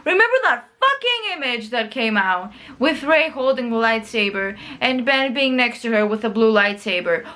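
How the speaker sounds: usual speaking voice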